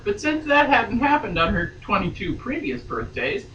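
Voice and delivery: steady read-aloud novel voice; story narration. A narrator's reading. Reading novel aloud on podcast.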